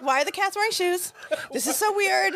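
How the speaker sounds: high, puzzled mock question; baffled. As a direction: act out bewildered confusion. Mock baffled movie viewer.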